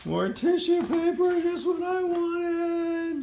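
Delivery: high, slow mock delight; faking delight at gift. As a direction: gush sarcastically over tissue paper.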